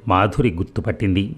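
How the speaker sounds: usual speaking voice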